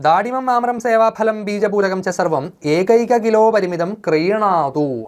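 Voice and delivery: slow, measured reading of the uncle's order; slow Sanskrit read. Acted. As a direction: recite it word by word. Uncle's reply message read. (പതുക്കെ സംസ്കൃത വായന)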